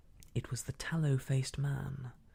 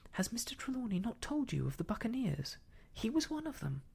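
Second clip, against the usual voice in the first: higher-pitched, eager informing; earnest boy's report; 9 semitones higher than usual. Acted. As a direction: tell it urgently, voice raised in pitch. Boy urgently informs Silver of buccaneer.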